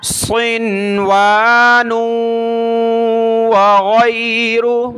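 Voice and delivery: slow, drawn-out chanted recitation; Quranic recitation. Shown as a demonstration. Teacher models the verse for the student, slow chant. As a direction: recite each word long and even.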